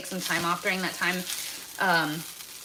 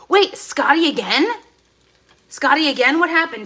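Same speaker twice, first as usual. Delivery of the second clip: louder, higher, alarmed "Scotty again?"; can't believe it happened again; shocked disbelief; 6 semitones higher, 6 dB louder than usual. Real, not acted. To chat, hearing Scotty was swatted again, shocked.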